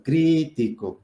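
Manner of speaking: crítico with a strong, lengthened CRÍ; stress on first syllable. Shown as a demonstration. Teacher showing where the voice's force falls, CRÍ stressed. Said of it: "La fuerza de la voz recae en la".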